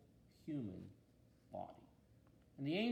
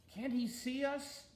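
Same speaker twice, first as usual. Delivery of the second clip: higher, slower, worried angel's question; anxious; 10 semitones higher, 4 dB louder, slower than usual. A narrator's reading. Angels asking God why man can't see them, anxious and higher. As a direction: ask it with rising worry, slow and pleading. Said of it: "asked the angels anxiously".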